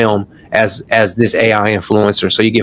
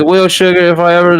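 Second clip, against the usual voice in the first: put-on Southern older woman; 7 semitones higher than usual. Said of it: "older woman, thick accent".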